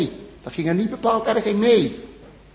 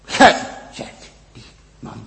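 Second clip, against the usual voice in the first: hushed, grave whisper; whispered accusation; 6 semitones lower than usual. Acted. Prophet whispering the verdict to the king, hushed and grave. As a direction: let the verdict fall in a whisper.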